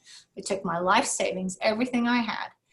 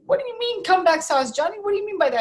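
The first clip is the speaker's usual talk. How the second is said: high, louder incredulous retold question; baffled questioning; 10 semitones higher, 5 dB louder, faster than usual. Workers asking Johnny about comeback sauce, baffled. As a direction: imitate confused staff pressing for an answer.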